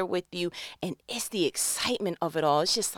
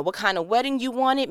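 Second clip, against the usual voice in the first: usual speaking voice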